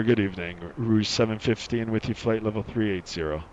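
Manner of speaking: loud, professional captain check-in; pilot radio voice. Pretend. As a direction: announce it like an airline pilot. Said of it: "I'll talk in a real pilot voice now".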